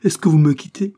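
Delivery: high, loud alarmed plea; frightened cry. A narrator's reading. Dying wife cries out in fear to her husband. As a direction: cry out in fear he's leaving. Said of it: "s'écria-t-elle ... avec effroi".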